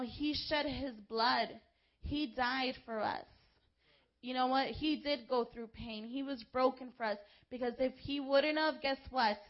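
ordinary delivery

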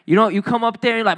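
ordinary talk, nothing marked

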